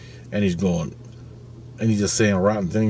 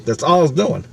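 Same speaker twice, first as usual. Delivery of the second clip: normal talking voice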